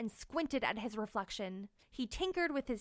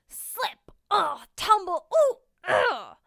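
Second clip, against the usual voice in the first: loud grunts and cries while falling; tumbling grunts; 8 semitones higher, 11 dB louder, slower than usual. Acted. Tank tumbling down rough terrain, loud grunting cries. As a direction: yelp your way down the slope.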